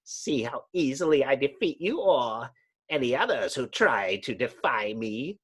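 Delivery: a hint of madness in a gloating villain; mad villain. Mole man boasting to the heroes, touch of madness. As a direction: gloat like a mad underground king. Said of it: "A note of madness creeps into the mole's voice".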